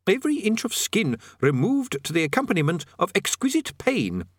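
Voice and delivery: mildly bright elaboration, dropping at the end; helpful. A narrator's reading. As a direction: add the grim detail obligingly. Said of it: "added the prisoner helpfully".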